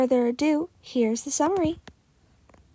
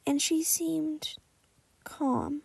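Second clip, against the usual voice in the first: soft, slow shy murmur; trailing off quietly; 3 semitones higher, 8 dB quieter, much slower than usual. A narrator's reading. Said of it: "he murmured".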